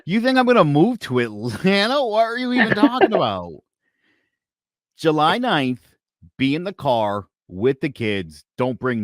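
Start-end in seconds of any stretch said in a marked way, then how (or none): 0.0-3.5 high, loud, fast disbelief; bursting out in disbelief; incredulous. Retold or imitated. Retelling his reply to his ex-wife, high incredulous.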